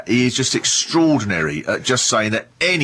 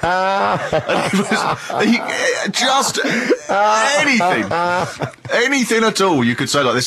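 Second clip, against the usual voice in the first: laughing; 9 semitones higher, slower than usual. Real, not acted. Laughing at the memory.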